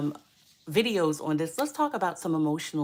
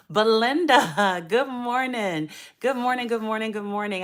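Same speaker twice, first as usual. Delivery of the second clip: excited greeting; 5 semitones higher, 6 dB louder than usual. Real, not acted. Greeting a viewer joining the live.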